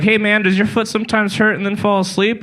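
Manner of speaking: teasing clerk checking for diabetes; mock official. Official's quote, mocked.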